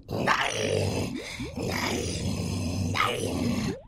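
drawn-out creature voice; monster voice. Acted. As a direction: drag it out like a beast.

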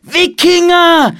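drawn-out, high, loud warning call; shouted alarm. A narrator's reading. Boy warns the monastery, shouted alarm. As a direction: shout the alarm slowly and high, falling at the end. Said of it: "rief Philipp".

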